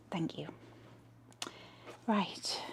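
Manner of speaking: soft, breathy thank you; whispered thanks. Real, not acted. Host whispering thanks to her viewers. Closing the channel plug.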